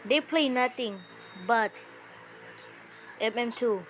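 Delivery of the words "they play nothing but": ordinary talk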